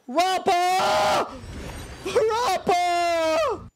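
high, long-held calls of the name; drawn-out shouting. Pretend. Streamer summoning Rappa, drawn-out shouts. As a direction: call out high, stretching the name. Calling for the character.

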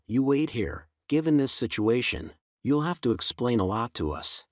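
ordinary delivery

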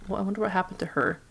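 ordinary talk, nothing marked

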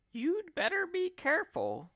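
high-pitched scolding wife voice; warning wife. Acted. Mrs Twit warns her husband to be careful, high-pitched warning. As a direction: warn him in a shrieky high voice.

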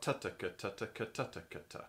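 ta ta ka ta ta ka ta ta ka ta spoken as triplet tonguing syllables; quiet spoken triplet tonguing pattern; tonguing syllables demo. Shown as a demonstration. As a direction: chant ta ta ka ta ta ka ta ta ka ta in triplets. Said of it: "So you've got".

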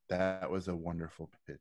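low, soft, emotionless reply; a hint of an unmoved studio boss, flat as stone; lightly voiced executive giving nothing away; deadpan Jeffrey. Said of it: "he's looking at me like this, zero emotion".

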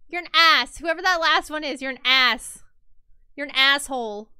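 loud scolding of the voter; angry insult. Real, not acted. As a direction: bark it at the chat.

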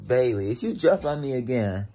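loud telling-off; scolding the dog. Real, not acted. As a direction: order the pet off sharply.

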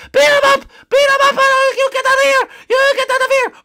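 very high, loud bunny yelling; squeaky hostile bunny. Acted. Bunny mob turning on the newcomer, shrieking squeakily. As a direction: squeal threats at full volume.

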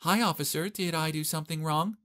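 high, sweet, put-on innocent voice; weird flirty voice. A narrator's reading. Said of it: "began to speak in a weird way".

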